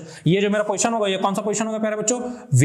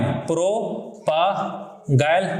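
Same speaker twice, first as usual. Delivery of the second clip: very slow, split-up "प्रोपागाइल"; syllable by syllable; 3 semitones lower, much slower than usual. Shown as a demonstration. Teacher stressing an important term, syllable by syllable. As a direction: stretch "प्रोपागाइल" into separate syllables with gaps.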